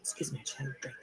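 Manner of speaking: soft whispered excuse; whispered aside. Real, not acted. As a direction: whisper it off-mic after a sip. Aside after taking a drink.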